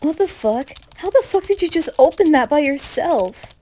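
ordinary delivery, nothing marked

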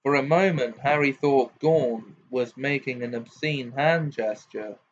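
usual speaking voice

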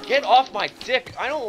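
loud, high yell; angry shout. Real, not acted. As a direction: shove them off with your voice. Swarmed by enemies.

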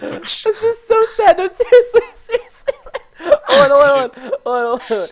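high, squeaky laughing through words; giggling too hard to talk. Real, not acted. Cracking up after fish bit.

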